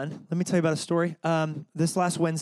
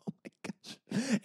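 awed breath; 7 semitones higher, 9 dB quieter, slower than usual. Retold or imitated. Overcome in worship.

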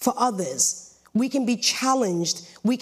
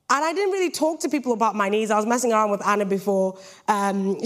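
normal talking voice

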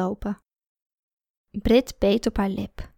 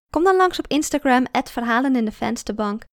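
usual speaking voice

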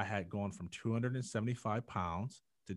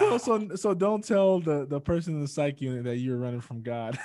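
laughing; 10 semitones higher, 14 dB louder than usual. Real, not acted. Laughing while joking.

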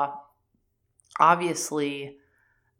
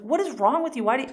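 high, louder mock outburst; exasperated scolding; 10 semitones higher, 5 dB louder, much faster than usual. [name] scolding him over the police, acted exasperation. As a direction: imitate someone at their wits' end, pitched up.

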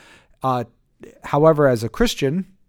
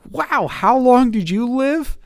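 drawn-out, high-pitched fake wonder; mock amazement; 12 semitones higher, 6 dB louder, slower than usual. Teacher mocking admiration of long lifespans, hammed-up amazement. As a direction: play up the awe, high and slow.

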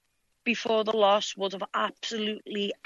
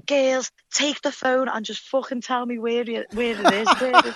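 retold excited plea, pitched higher; flustered excitement; 6 semitones higher than usual. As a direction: act out begging the girls to read it. Retelling excited reaction to fight text.

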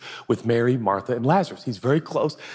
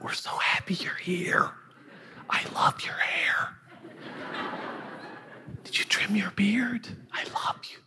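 gushing adoration; 9 semitones higher, 8 dB quieter than usual. Acted. Little sister fawning over her honored guest, gushing whisper. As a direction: whisper it like a smitten admirer.

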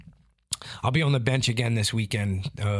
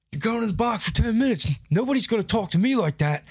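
higher, strict ref handing out the penalty; mock referee; 11 semitones higher, 3 dB louder than usual. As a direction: mimic the ref laying down the law. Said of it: "And he's like".

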